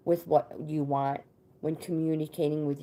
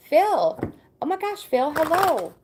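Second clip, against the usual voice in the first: excited greeting; 10 semitones higher, 4 dB louder than usual. Real, not acted. Host greeting a long-missed viewer, thrilled and high. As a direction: squeal a surprised, happy welcome.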